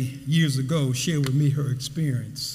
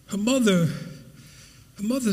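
ordinary talk, nothing marked

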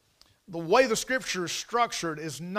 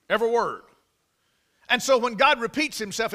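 raised, louder preaching; preaching at full force; 4 semitones higher, 4 dB louder than usual. Real, not acted. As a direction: drive it home to the congregation.

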